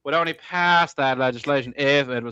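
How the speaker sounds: put-on self-conscious old Southern gentleman; William F Buckley. Podcaster mimics a debater on legislation, expansive drawl. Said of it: "that kind of expansive kind of drawl".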